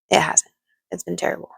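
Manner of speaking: quiet, honest admission after the joke; sincere. Real, not acted. Admitting to viewers how life really was, softly sincere.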